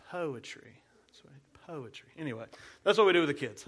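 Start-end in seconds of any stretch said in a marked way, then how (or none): 0.1-2.1 softer, hushed "poetry" said with a bit of class; classy poetry. Shown as a demonstration. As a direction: put on a little class for "poetry". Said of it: "You gotta say it with a little, a little class".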